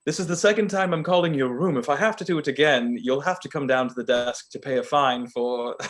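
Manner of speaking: hammed-up British hotel clerk; awful London accent. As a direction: scold in a badly faked London accent. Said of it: "this is a very awful accent".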